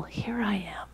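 hushed, breathy "Here I am"; reverent whisper. Acted. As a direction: Moses answering God at the burning bush, whispered. Moses' reverent answer.

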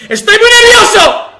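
high, loud burst of nerves; nervous outburst. Real, not acted. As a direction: shout out the nerves.

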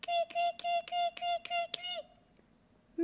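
high, thin chirping; bird chirping. A narrator's reading. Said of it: "l'oiseau piaille de toutes ses forces".

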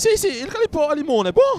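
falsetto buffo. Acted. Risposta buffa in falsetto.

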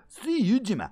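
high, loud Scots holler; hammed-up Scottish challenge; Scottish accent. Shown as a demonstration. Streamer offering a swear-free line, shouted Scottish accent. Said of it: "my Scottish accents".

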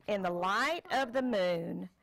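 put-on reading to a child. Parent reads picture book aloud.

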